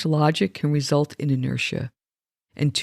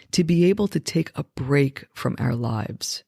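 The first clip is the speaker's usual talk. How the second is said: ordinary talk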